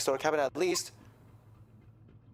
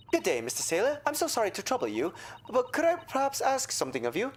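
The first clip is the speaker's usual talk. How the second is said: ordinary delivery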